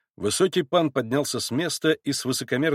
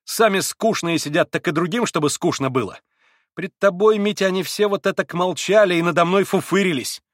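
higher, louder grumbling outburst; vexed complaint; 7 semitones higher, 5 dB louder than usual. A narrator's reading. Grushenka complains to Mitya about the Poles, vexed and raised. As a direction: pour out the grievance. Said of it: "почти взвизгнула в досаде Грушенька".

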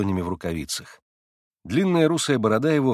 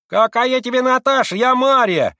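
much higher, loud indignant yell; shrill market-woman shout; 12 semitones higher, 8 dB louder than usual. Acted. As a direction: yell back like a vendor under her awning. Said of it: "крикливо ответила торговка".

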